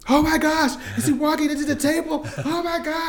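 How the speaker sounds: very high, hammed-up alarm; mock panic. Parent watching toddler near the table, mock-panicked cry.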